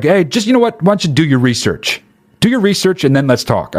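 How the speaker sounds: high, smug brush-off; mock condescension. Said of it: "I wouldn't be like".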